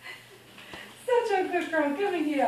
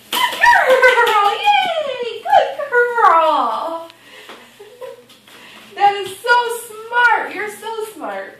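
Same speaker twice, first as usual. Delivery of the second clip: excited dog praise; 2 semitones higher, 5 dB louder, slower than usual. Real, not acted. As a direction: cheer the dog on loudly. Praising puppy.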